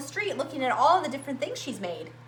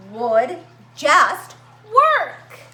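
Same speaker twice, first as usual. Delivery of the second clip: frustrated strain; 8 dB louder, much slower than usual. Acted. Frustrated inventor straining at the machine.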